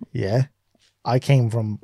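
ordinary talk, nothing marked

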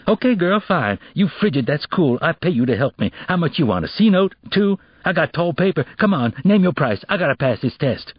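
high, brash haggling voice; pushy teen boy. Acted. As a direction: push her to name a price. Kevin bargaining.

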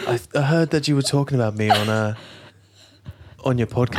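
unconvincing celebrity impression; lightly put-on film-star voice, hesitant; half-hearted impression of an interviewed actor; Orlando Bloom. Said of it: "heard Orlando Bloom speak like that".

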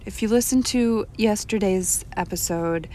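ordinary delivery

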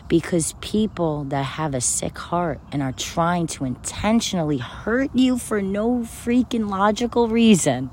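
ordinary talk

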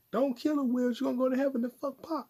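high-pitched mock disbelief; incredulous requote. Podcaster echoing a shocking rap lyric, incredulous high voice. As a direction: repeat it way up high, scandalized.

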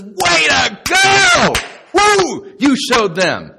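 huge, high, drawn-out victory shout; mock cheering. Jesus greeting you at the very end, mock cheering. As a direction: whoop like an over-the-top fan at the finish.

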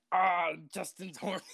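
lightly voiced grandpa leaning out the window; a hint of a drawling old man; imitating grandpa. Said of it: "he goes".

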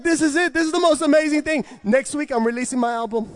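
high, loud, fast delight; thrilled exclamation. Retold or imitated. Thrilled at rapping in tongues, exclaiming high and loud. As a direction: gush with amazement.

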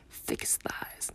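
whispered joke. Real, not acted. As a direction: breathe it out as a secret joke. Joking aside under her breath.

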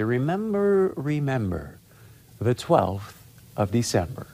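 slow, theatrical masked-avenger recital; V for Vendetta quote. Retold or imitated. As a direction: intone it like the film's opening rhyme. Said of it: "you know what I'm doing here when I say".

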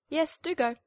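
high, loud, curt hen; dismissive hen. Acted. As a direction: shoo the duckling off. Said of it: "replied the hen".

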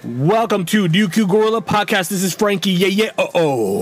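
upbeat show opening; announcer intro. Acted. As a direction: announce the podcast.

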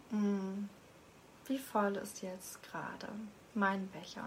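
calm guiding voice, whispered questions; guided meditation. Acted. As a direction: lead them inward, gently. Leads a short meditation.

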